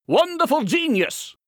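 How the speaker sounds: high-pitched, gushing praise; gushing acclaim. A narrator's reading. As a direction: proclaim it high and admiringly. Journals acclaim the writer.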